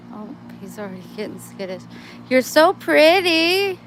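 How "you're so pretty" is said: high, slow, loud cooing drawn out on so. Real, not acted. Cooing at a kitten.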